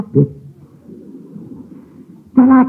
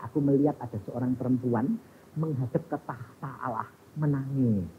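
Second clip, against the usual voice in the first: low, hushed, reverent tone; solemn vision recounting; 8 semitones lower, 15 dB quieter than usual. Retold or imitated. Son telling of his vision before God's throne, hushed and solemn. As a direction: softly recount it in awe.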